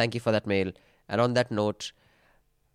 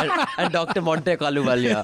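laughing jab; 3 semitones higher than usual. Real, not acted. Mocking joke about fake doctors.

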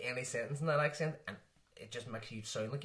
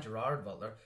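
ordinary talk

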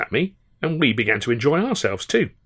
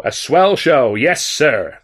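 American shout; 4 semitones higher, 5 dB louder, much slower than usual. A narrator's reading. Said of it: "he shouted above the din".

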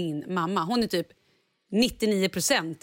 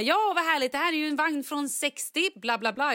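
higher, chirpy conductor; glad konduktör; 7 semitones higher than usual. Retold or imitated. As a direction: gush about the old carriage. Härmar konduktören. (konduktören berättar glatt om vagnen, högre)

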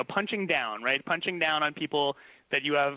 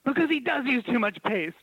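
higher, huffy know-it-all retort; teacher snapping back at the mother, higher and indignant; 7 semitones higher than usual. Retold or imitated. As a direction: defend the paste like it matters. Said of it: "she's so indignant".

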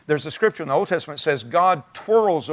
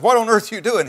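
mock-incredulous policeman, louder and higher; loud, high-pitched mock astonishment; startled demand; 6 semitones higher, 5 dB louder than usual. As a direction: retell a baffled challenge, pitched up.

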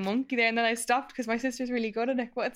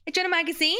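hairdresser chat; 7 semitones higher, faster than usual. Pretend. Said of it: "your hairdresser chat".

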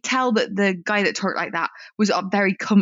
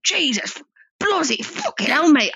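imitating a brash bloke; 8 semitones higher, slower than usual. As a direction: drag out the amazed swearing like the regular did. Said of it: "he was talking like that".

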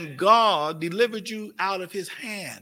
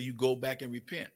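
a soft, much lower voice of the Holy Ghost; Holy Ghost speaking; 6 semitones lower, 6 dB quieter than usual. Retold or imitated. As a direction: lower and softer, a gentle inner command. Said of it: "Holy Ghost said".

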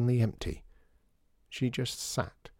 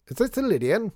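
called up, high and loud; calling from below; 11 semitones higher, 6 dB louder than usual. Acted. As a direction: call it up the stairs. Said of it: "called a voice from below".

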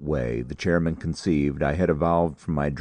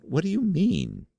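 surprised question. Acted. Chairman echoes in surprise.

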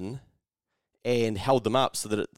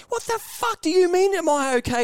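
very high, louder mock-furious outburst; losing it; 5 dB louder than usual. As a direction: imitate an outraged pedestrian, pitch shooting right up. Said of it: "just losing it".

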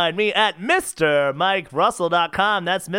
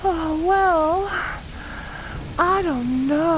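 put-on higher girlish voice, politely refusing; a young girl turning down a stranger, prim and high; wary girl; 8 semitones higher, slower than usual. Girl refusing the stranger's ride, high and prim. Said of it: "so here are the lyrics".

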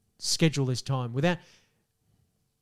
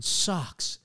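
"sucks" softly, with American vowel; quiet American-accented single word; 2 semitones higher, 4 dB quieter than usual. Shown as a demonstration. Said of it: "if you say it right".

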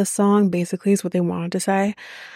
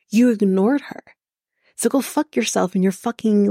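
normal talking voice

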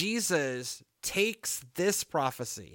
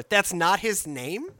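usual speaking voice

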